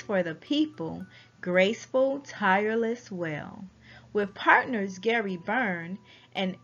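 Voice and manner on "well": usual speaking voice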